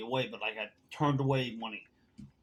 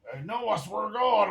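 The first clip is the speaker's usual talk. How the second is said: higher-pitched mimicry of a protesting drinker; mock protest; 7 semitones higher than usual. Accused bartender protests his innocence to a friend, mock protest. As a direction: imitate an insistent, defensive denial.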